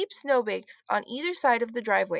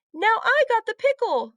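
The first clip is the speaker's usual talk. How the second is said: higher, louder triumphant call; excited announce; 11 semitones higher, 5 dB louder than usual. A narrator's reading. Heidi unwrapping the pickle ornament, delighted. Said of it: "said Heidi".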